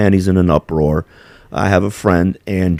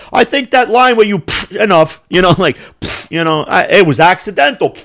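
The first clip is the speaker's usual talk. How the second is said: mock excuse; 8 semitones higher than usual. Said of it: "it's just like".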